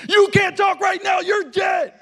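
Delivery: high, loud screaming tirade; drill sergeant shout. Retold or imitated. Marine pastor screaming at his late youth pastor, furious high shout. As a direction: scream it like a Marine chewing out a recruit. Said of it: "cuts him off".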